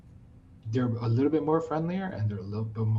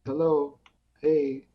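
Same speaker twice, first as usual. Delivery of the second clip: higher-pitched run of called-out hellos; assorted greetings; 5 semitones higher, 3 dB louder, much slower than usual. Retold or imitated. Man greeting ignoring passers-by every which way, assorted greetings. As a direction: try one hello after another. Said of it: "every language, every accent".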